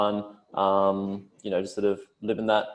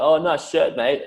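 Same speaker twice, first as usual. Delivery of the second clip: higher sarcastic praise; mock sarcastic compliment; 6 semitones higher, slower than usual. Mate teasing about a shirt, sarcastic mock compliment. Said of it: "say, like".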